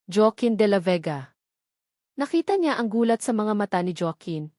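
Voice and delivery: ordinary delivery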